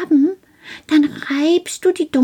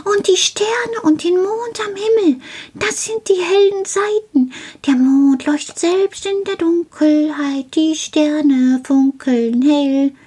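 high, thrilled little-man voice, settling gradually; Karl, very excited; 10 semitones higher than usual. A narrator's reading. Little man lists the sun, stars and moon, high excited voice. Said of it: "rief Karl ganz aufgeregt".

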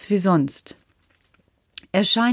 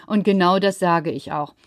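plain reading voice

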